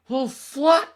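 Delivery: very high, loud squealed outburst; mock squeal. Speaker breaking off the story with a joke, mock squeal. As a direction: shriek it high, then drop toward your own pitch.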